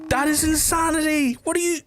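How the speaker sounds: high astonished squeal; high disbelief. Retold or imitated. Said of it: "And I was like".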